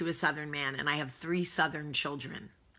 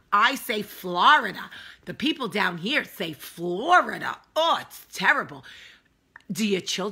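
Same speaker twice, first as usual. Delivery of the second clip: put-on Northern accent; 3 semitones higher, 6 dB louder than usual. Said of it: "I like to do a northern accent".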